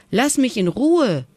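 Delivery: high, fed-up little call; a child's cry to be left alone, not a full shout; mild high call of protest. A narrator's reading. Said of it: "rief sie".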